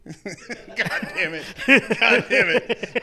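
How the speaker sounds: drawn-out laughing groan, slow. Real, not acted. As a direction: groan it out slowly through laughter. Laughing after a blunder.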